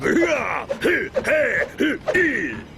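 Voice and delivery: big booming hero laugh; hearty heroic laugh. Acted. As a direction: throw your head back and laugh heroically. Hero boasting before adventure.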